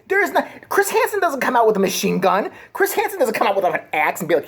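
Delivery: ordinary talk, nothing marked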